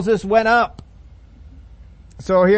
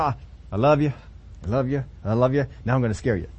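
softer and lower, sweet then teasing; tender God voice; 6 semitones lower, 4 dB quieter, faster than usual. Acted. God softly telling his people he'll scare them. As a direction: coo it, then turn mischievous.

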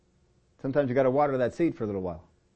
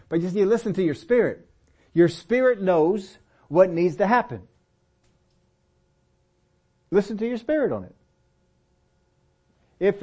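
raised, slowed, urgent exhortation; preaching; 6 semitones higher than usual. Acted. Preacher urging the congregation to trust their spirit, preaching hard. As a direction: press it on the flock like a warning.

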